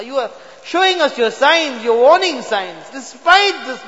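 ordinary talk, nothing marked

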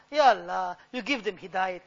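normal talking voice

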